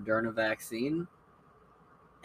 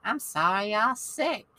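much higher, slow Southern granny sympathy; sweet Southern old lady; slower than usual. Retold or imitated. Lily apologizing to her sick roommates, high Southern coo. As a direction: coo an apology like a kindly grandma.

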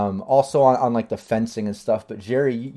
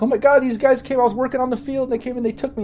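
high, pleading tearful voice; a touch of sobbing as she explains; crying a little; 4 dB louder, faster than usual. Acted. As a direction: sister telling her brother she was taken. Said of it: "she starts crying a little and explaining".

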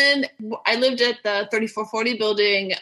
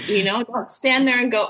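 normal talking voice

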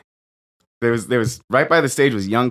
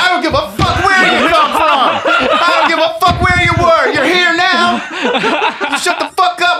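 much higher, louder mock shouting, laughing through it; retold screaming rant; 11 semitones higher, 4 dB louder than usual. Comic screams at old hecklers, hammed-up furious yelling. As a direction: scream it like losing it on stage. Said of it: "You got on a real boomer tear".

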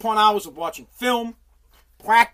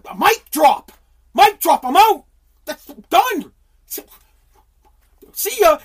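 higher, louder cocky sign-off; mock smug exit; 6 semitones higher, 8 dB louder, slower than usual. Mocking a smug leader leaving, a higher, louder cocky sign-off. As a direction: toss it off like a mic drop.

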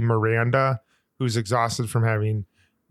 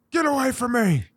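high, strained mock yell; hammed-up New York meltdown, pitched way up; mock monster outburst; 12 semitones higher than usual. Agitated New Yorker erupting at the crowd, mock monstrous. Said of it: "Kind of acting like a monster".

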